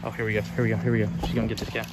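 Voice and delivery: ordinary delivery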